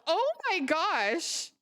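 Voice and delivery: high, breathless delighted thanks; overjoyed squeal. Real, not acted. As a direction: squeal with stunned gratitude. Gifted subs, streamer overwhelmed.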